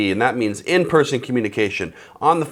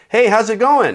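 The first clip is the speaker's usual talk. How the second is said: much higher and louder, friendly; cheerful greeting; 9 semitones higher, 6 dB louder than usual. Shown as a demonstration. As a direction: greet the cashier brightly. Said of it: "I do the same thing at the grocery store".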